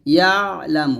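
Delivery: "يعلم" with a held throat stop on the ayn; correct ayn. Shown as a demonstration. Teacher showing the right reading of "يعلم", ayn without vowel. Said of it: "এরকম ভাবে পড়বেন না".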